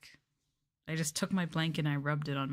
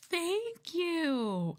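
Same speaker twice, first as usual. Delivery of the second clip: cute thanks; 12 semitones higher than usual. Acted. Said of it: "You're a pretty good actress".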